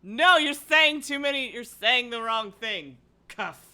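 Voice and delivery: high, loud bossy censor voice; mock scolding voice. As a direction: play a shrill scolding authority. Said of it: "YouTube was like".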